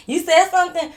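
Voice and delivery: high, loud sharp question; mock challenge. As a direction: toss it back like a dare. Men turn around challenging.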